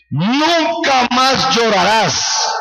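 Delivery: high, slow scripture declaration; forceful proclamation. Acted. Preacher reading God's promise to the congregation, forceful proclamation. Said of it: "esa palabra con tanta fuerza".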